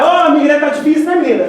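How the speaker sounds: high, loud teasing jab with a laugh; mocking the girl. Speaker mocks a sulky Burger King attendant, loud teasing. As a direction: needle the grumpy attendant, laughing.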